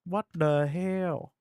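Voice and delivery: slow, drawn-out what the hell; stunned repeat; slow disbelief. Real, not acted. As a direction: stretch every word out in disbelief. Repeats reaction, slower.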